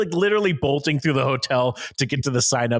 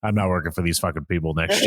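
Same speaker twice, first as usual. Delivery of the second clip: low grumble; 8 semitones lower than usual. Retold or imitated. Grumbling tech guy.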